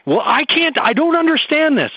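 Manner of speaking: put-on high, complaining teenage voice; whiny teen. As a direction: imitate a frustrated teenager. Acted teen whining.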